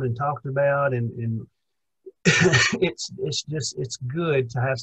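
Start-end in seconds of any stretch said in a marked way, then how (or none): 2.0-2.8 short loud chuckle; real chuckle. Real, not acted. Co-host recalling being ridiculed for faith, real chuckle. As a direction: chuckle briefly before resuming the thought.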